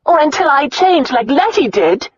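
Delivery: loud, high angry retort. A narrator's reading. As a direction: shout it back at him. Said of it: "Her voice was just as loud".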